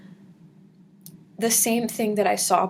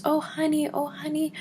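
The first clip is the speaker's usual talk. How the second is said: comforting; 8 semitones higher than usual. Retold or imitated. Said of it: "asked why I was crying".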